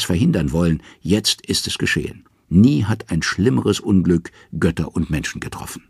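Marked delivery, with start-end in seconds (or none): none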